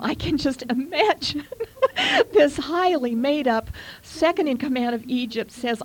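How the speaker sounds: emotional. Real, not acted. Preacher picturing Joseph before his brothers, emotional. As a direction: let real emotion lift your voice. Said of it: "emotional just reading this".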